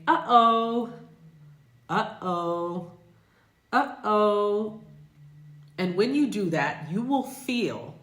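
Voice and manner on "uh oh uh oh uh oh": Uh-oh with a clean catch in the throat between syllables, louder and higher at first; glottal stop demo. Shown as a demonstration. Teacher has students test the glottal stop with Uh-oh. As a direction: say Uh-oh, Uh-oh feeling the throat close. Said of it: "and say".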